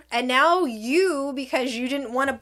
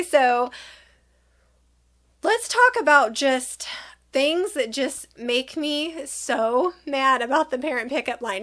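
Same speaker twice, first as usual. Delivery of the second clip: ordinary delivery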